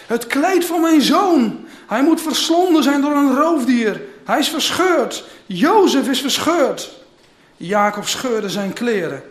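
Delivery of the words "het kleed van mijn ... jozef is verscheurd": loud, high, drawn-out cry of a father; anguished outcry. A narrator's reading. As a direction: cry out high and slow over a lost son. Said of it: "Jacob herkende het en riep uit". (luide, hoge, gerekte uitroep)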